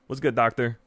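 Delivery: ordinary talk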